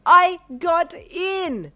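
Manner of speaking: drawn-out yell. Acted. Teen yelling her news, very slow and high. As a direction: stretch the yell out.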